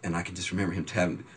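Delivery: normal talking voice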